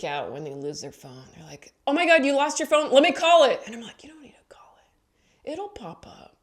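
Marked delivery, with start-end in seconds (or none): 1.9-3.6 high, loud, fast fuss; hammed-up alarm; mock panic. People panicking over phone. 4.0-6.4 soft whispered shrug; calm secretive whisper. Retold or imitated. Whispered reply, unbothered.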